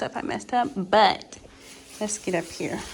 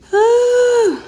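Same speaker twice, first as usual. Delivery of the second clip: relieved whew; 19 dB louder than usual. Real, not acted. Relief after long job.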